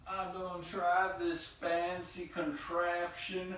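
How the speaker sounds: slow, high, hammed-up southern twang. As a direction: drawl it out in an exaggerated Southern accent. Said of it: "like, a southern twang now".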